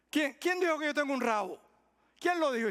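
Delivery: high, loud offended voice; hammed-up indignant demand; indignant mock outrage. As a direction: play the offended one loudly. Acted indignant denial.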